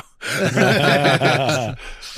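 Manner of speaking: a big burst of real laughter; loud laugh. Real, not acted. Host laughing at his own punchline, hearty laugh.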